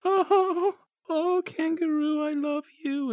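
high, slow mock-sobbing girl's voice; a little girl's hammed-up crying farewell; mock tearful little girl. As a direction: wail it like a heartbroken little girl. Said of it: "Dot bursts into tears and goes".

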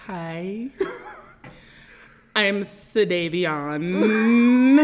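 very slow, elongated words, last sound held; drawn-out mocking impression; Sadavion. Co-host asked for an impression, slow elongated mockery. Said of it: "do you wanna do an impression of me".

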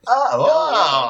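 high, drawn-out delighted "oh"; hammed-up excited cooing; mock excitement. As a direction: act thrilled at the leaping flames. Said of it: "It had quickly changed to excitement".

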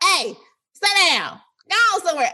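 high-pitched imitation of scolding kids; mock telling-off. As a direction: call out sharply, pitch jumping up. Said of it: "my voice would have been like".

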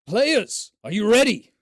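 loud rising call to the players; hype call. Real, not acted. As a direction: pump up the table. Calling out to players.

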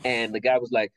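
normal talking voice

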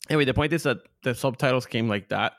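usual speaking voice

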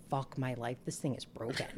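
low quiet groan of a line; muttered despair. Retold or imitated. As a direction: mutter it under your breath. Retold inner despair, low quiet.

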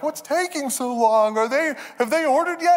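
put-on high, whiny little-girl voice from the back seat; impatient kid voice. Daughter in back seat asks dad why it takes so long, whiny high kid voice.

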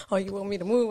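ordinary talk

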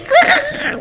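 very high mock bird scream; sudden high-pitched bird-call imitation; imitated bird screech. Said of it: "They scream like".

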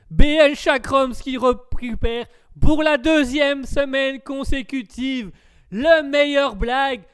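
high, loud, drawn-out announcer voice; hammed-up award announcer. Host awards a listener the best-joke Oscar, hammed-up announcer. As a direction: belt out the winner like an awards host.

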